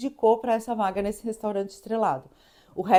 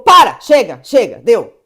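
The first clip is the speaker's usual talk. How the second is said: loud, abrupt mock orders; punchy shouted commands, each one separate; acted shouted halt; 14 dB louder than usual. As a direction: imitate a chef bellowing to stop. Chef calls a halt, acted.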